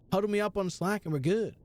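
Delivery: normal talking voice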